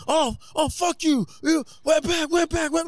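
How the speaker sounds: high yelled slurs in a fight; hammy imitation of a brawler yelling; mock fighter yelling.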